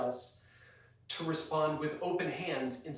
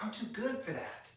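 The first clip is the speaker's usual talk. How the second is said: smug; 6 semitones higher, 6 dB quieter than usual. Retold or imitated. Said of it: "You're like".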